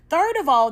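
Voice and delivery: high, loud "Third of all"; emphatic count. Real, not acted. Listing reasons to the viewers, stressing "Third of all". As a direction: punch "Third of all" as the final point.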